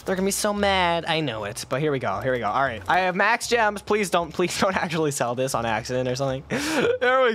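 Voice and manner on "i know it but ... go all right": ordinary delivery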